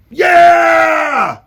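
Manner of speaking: piercing high yell; rat shouting. A narrator's reading. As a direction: scream agreement like a rat. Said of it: "shouted another".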